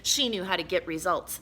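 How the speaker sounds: ordinary delivery, nothing marked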